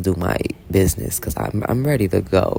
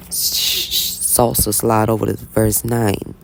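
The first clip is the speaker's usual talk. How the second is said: hushed whisper; slower than usual. Real, not acted. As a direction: whisper it quickly. Unvoiced aside.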